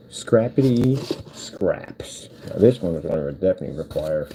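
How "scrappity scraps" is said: very slow, drawn-out syllables; playful drawl. Real, not acted. Playing with the phrase while building from scraps, playful slow drawl. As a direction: stretch the words out, higher.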